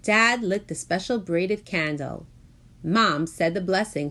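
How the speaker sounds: ordinary delivery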